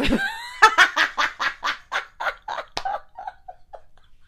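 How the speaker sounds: long, high, loud cackle; real helpless laughing fit; cackling laugh. Real, not acted. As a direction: laugh hard until it trails away. Laughs at her own insult.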